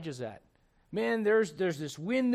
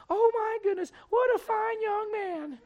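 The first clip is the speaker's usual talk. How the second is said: put-on much higher, slow sweet old-lady voice; 5 dB louder, slower than usual. Old lady thanking the young man at the door, much higher and slow. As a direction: gush like a grateful grandmother. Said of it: "an old lady that walks to a door".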